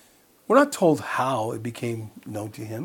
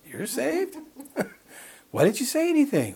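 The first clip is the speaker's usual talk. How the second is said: surprised; 11 semitones higher than usual. Retold or imitated. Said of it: "I'm like".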